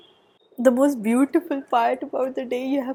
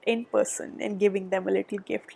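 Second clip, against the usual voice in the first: usual speaking voice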